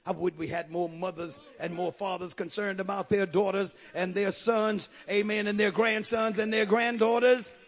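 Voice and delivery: ordinary talk, nothing marked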